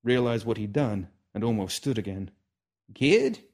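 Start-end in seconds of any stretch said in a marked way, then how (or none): 2.9-3.4 put-on old man's voice. Old man addressing nervous kid.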